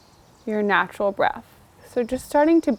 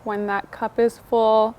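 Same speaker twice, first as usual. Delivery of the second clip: guided meditation; 3 dB quieter than usual. Acted. Teacher leading a seated class through breathing, soft meditation voice. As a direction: ease the listener into the breath.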